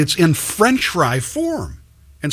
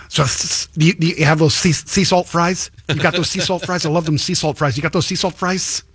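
imitated stammering customer, higher-pitched; speech impediment; 3 semitones higher than usual. Man in line pestering the counter lady, imitated speech impediment. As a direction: stumble on the s sounds, then repeat the question eagerly. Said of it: "a speech impediment. And he kept saying".

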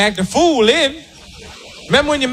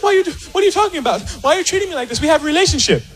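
high pleading woman; 5 semitones higher, much faster than usual. Retold or imitated. Woman pleading with cold man.

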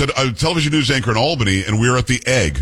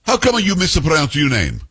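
put-on higher, slow Italian-accented woman's voice; thick Italian accent; 5 semitones higher than usual. Woman confronting the news anchor about his name, thick Italian accent. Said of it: "a thick, um, Italian accent".

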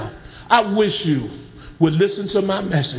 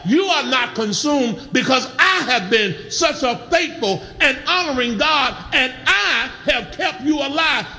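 raised, driving sermon delivery with insistent repeated phrases; impassioned preaching; 5 semitones higher than usual. Acted. Preacher rousing the congregation to praise a good God, impassioned preaching. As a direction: preach it hard, pitch climbing, then drop on the closing words.

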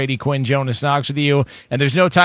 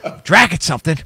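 building, laughing, higher second time; dad impression on request; 7 semitones higher, 4 dB louder, faster than usual. Retelling for co-hosts his dad's freak-out, rising exasperation. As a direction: rant like a dad losing his temper. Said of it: "Do it one more time".

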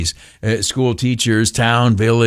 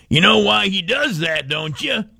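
grandpa voice; 7 semitones higher than usual. Retold or imitated. Grandpa teasing the kid.

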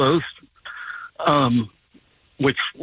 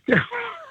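high laugh; 4 dB quieter than usual. Real, not acted. Laughing at his own story.

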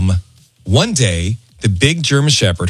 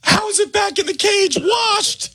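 high, shocked shriek; horrified shriek; slower than usual. Retold or imitated. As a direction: shriek it out in disbelief. Neighbor's horrified shout retold.

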